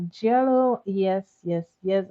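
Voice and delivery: usual speaking voice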